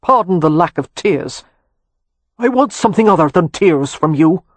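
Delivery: a deposed monarch's measured account; old Irish earl. A narrator's reading. Voices the old earl Cavan.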